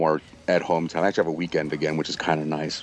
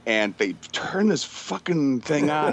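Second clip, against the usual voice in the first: normal talking voice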